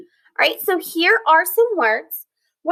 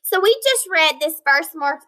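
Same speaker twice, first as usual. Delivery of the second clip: ordinary delivery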